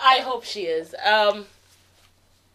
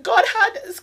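high voice breaking into a teary laugh; tearful; 10 semitones higher, 7 dB louder than usual. Real, not acted. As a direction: push the words out through tears. Breaking down recalling family's survival.

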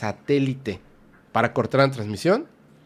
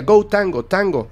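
radio callout; 6 semitones higher, 4 dB louder, slower than usual. Retold or imitated. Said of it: "le decía".

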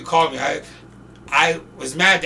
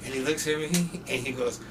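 ordinary talk, nothing marked

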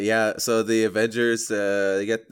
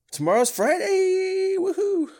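excited cheer; 4 dB louder, slower than usual. Real, not acted. Cheering for the weekend.

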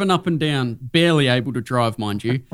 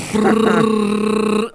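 printer noise; 7 semitones higher, 3 dB louder than usual. Retold or imitated. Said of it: "he's like".

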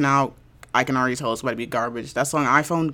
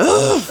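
annoyed groan; 7 dB louder than usual. Retold or imitated. Groaning after hanging up.

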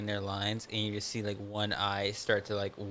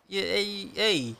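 higher mock mob boss voice; 9 semitones higher, much slower than usual. Mocking the husband as a little mob boss. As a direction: ham up the Italian mobster. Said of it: "a little mob boss".

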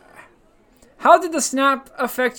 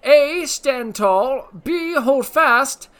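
slow, deliberate game show question read; quiz question read; 4 semitones higher, 7 dB louder, much slower than usual. Acted.

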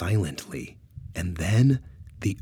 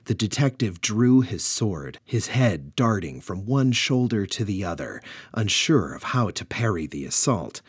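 ordinary delivery, nothing marked

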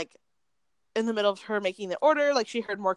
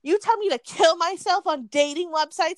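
higher-pitched, drawn-out mock tirade; mock angry rant; 6 semitones higher than usual. Retelling an angry customer at the bagel shop, mock ranting. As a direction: imitate an aggrieved customer, pitched up.

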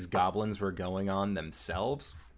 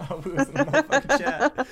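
high, loud laughing shout; 7 dB louder, faster than usual. Real, not acted. Laughing after natural twenty.

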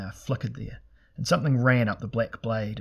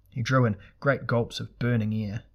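ordinary delivery, nothing marked

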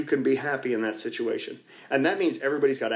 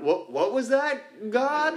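higher, slow, baffled asking; mock startled question; 10 semitones higher, much slower than usual. Praying group stunned by a voice, mock startled. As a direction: pitch it up like you can't believe your ears.

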